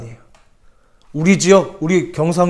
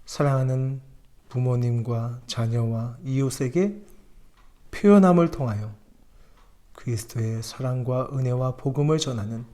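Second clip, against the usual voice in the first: low, soft prayer voice; 3 semitones lower, 6 dB quieter than usual. Acted. Pastor prays for the congregation, soft prayer. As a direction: pray humbly and quietly.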